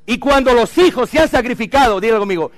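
loud, high call to the congregation; rousing preaching. Acted. Preacher calling the congregation to repeat after him, loud and high. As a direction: lift the crowd, make them repeat it.

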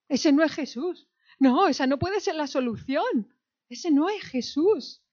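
high, protesting rejection; incredulous mock protest; acted disbelief. Voices disciples rejecting who they see.

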